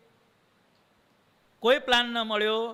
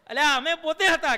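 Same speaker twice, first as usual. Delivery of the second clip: higher and louder, a joyful unveiling; excited reveal; 8 semitones higher, 4 dB louder than usual. Retold or imitated. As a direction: burst out with the secret, beaming. Maharaj reveals himself. (ઉત્સાહથી, ઊંચા અને મોટા અવાજે રહસ્ય ખોલવું)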